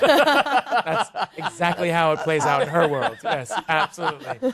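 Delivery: loud, high, through big laughter; delighted, real laughing confirmation; laughing agreement. Real, not acted. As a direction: crack up as you heartily agree.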